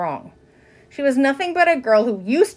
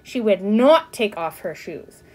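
ordinary delivery

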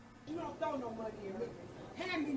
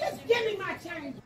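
higher and louder, demanding her change; angry demand; 7 semitones higher, 10 dB louder than usual. Real, not acted. Angry customer yelling at the cashier for her change, loud demand. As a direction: snap at the cashier to hand it over.

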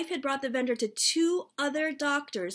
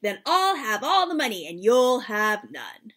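playful taunting dad, louder; teasing; 7 dB louder than usual. Acted. Dad teasing his kids over dumplings, playful gloating. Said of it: "Dad teased".

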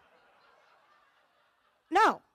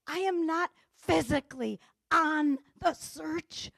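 ordinary talk

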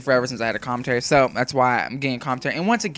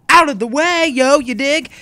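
mock thug voice; 9 semitones higher, 9 dB louder than usual. Mock tough-guy slang.

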